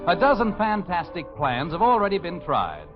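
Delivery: usual speaking voice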